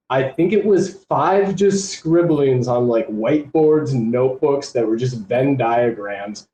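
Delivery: ordinary talk, nothing marked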